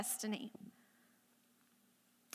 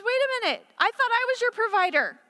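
God correcting; 9 semitones higher, 5 dB louder than usual. Retold or imitated. God's gentle correction voiced.

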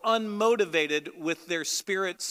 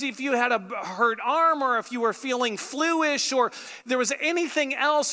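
ordinary talk, nothing marked

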